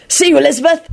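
high, cheery farewell; enthusiastic. A narrator's reading. Sirius leaving Elizabeth, enthusiastic. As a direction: call goodbye with a grin. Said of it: "He bolted off enthusiastically".

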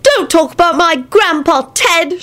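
high-pitched mild shriek; exasperated high kid voice; exasperated child. Acted. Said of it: "shrieked Teddy in exasperation".

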